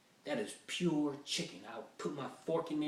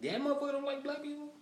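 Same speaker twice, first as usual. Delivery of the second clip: much higher, fast, incredulous; young man stunned someone hates him, higher; shocked question; faster than usual. Retold or imitated. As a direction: squeak it in disbelief.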